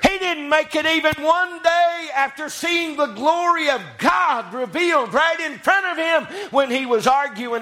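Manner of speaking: high, intoned pulpit delivery; full-force preaching. Real, not acted. Preacher on Peter failing within one day, preaching hard.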